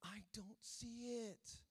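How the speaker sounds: put-on slow, breathless whisper of a little kid; soft whispered child voice, slowly; whispered toddler reply. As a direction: murmur it barely voiced, like a shy two-year-old. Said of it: "it's like".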